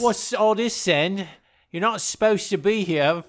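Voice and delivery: hammed-up Cockney zoo worker. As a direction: scold in a mock Cockney accent. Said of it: "Who's Cockney".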